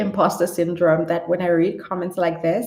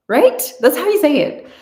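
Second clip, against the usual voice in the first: proud; 9 semitones higher, 7 dB louder than usual. Real, not acted. Proud after attempt.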